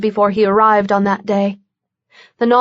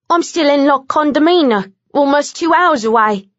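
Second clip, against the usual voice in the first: frustrated; 5 semitones higher than usual. A narrator's reading. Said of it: "replied with open frustration".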